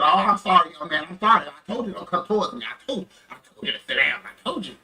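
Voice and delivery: hurried, insistent apology; Officer Patrick. Retold or imitated. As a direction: imitate an officer apologizing, then justifying himself quickly. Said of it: "Officer Patrick felt bad".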